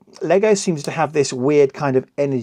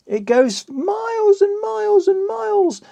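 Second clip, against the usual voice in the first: high, squeaky mock amazement; high hammed-up wonder. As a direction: squeal it out in a cartoon voice. Exaggerating pieces flying off.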